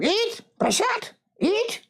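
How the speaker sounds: high, slow, pushy command to eat; insistent high order. Retold or imitated. Lady forcing spicy prasad on the young monk, insistent high voice. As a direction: push the food on him like a relentless host. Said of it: "And you're going".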